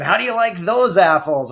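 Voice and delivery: loud, high gloating movie-star line; Matt Damon impression. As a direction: slam it down like a triumphant movie hero. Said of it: "pull out the Matt Damon".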